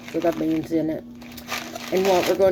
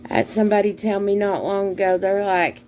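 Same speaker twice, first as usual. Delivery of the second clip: usual speaking voice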